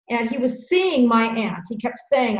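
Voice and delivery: usual speaking voice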